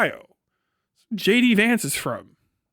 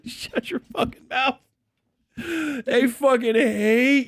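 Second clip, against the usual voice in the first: laughing; 5 semitones higher, much slower than usual. Real, not acted. Cracking up at own bit.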